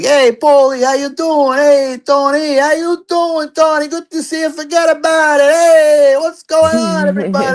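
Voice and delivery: high, loud hammed-up goomba greeting; loud Italian goomba. Slick Italian guy greeting the room, hammed-up and loud. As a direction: holler hellos like a cartoon Italian tough guy. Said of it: "And he was like".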